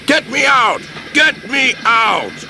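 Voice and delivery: high, urgent engine cry; engine demanding rescue. A narrator's reading. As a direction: cry out high, stretching the repeat.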